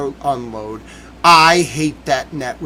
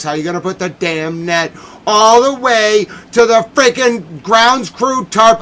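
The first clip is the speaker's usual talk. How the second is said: higher, louder, slowing at the end in outrage; angry rant; 6 semitones higher, 7 dB louder than usual. Real, not acted. Fan venting to George about the net, angry. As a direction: stretch the last words in disbelief.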